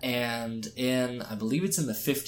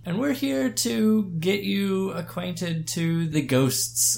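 slow theatrical villain menace; 9 semitones higher, 5 dB louder, slower than usual. Acted. Spooky intro continues.

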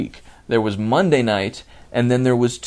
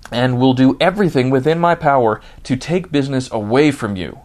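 angry rant; 3 semitones higher, 4 dB louder than usual. Real, not acted. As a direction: vent your outrage at the company, louder and louder. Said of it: "I am doing this rant".

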